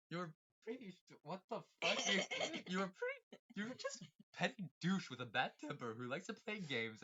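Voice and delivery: laughing. Acted. Reading the insult, laughing mid-way.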